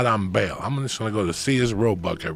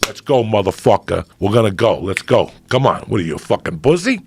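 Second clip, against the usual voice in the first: loud, pumped self-talk; self pep talk; 8 dB louder than usual. Retold or imitated. Pumping himself up to leave the house, loud. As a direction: psych yourself up out loud. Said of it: "You had to talk to yourself".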